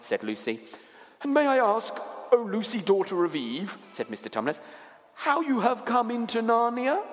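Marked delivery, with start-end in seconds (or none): 1.2-7.0 high, formal faun voice; politely wondering creature; courteous, curious faun. Acted. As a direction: ask with high-pitched ceremony.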